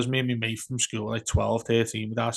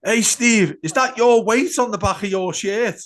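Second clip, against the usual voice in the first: mock heckle; 8 semitones higher, 6 dB louder than usual. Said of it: "and he goes".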